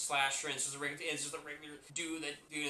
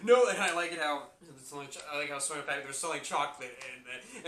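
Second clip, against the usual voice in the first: normal talking voice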